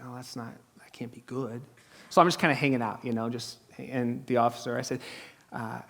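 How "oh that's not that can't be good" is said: hushed aside. Real, not acted. Seeing the girl handcuffed.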